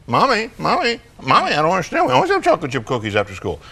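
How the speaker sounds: put-on high little-kid voice, speeding up; puzzled child. Four-year-old follows mom after a curveball, high puzzled child voice. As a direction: imitate a bewildered child protesting. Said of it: "you've thrown him a curveball".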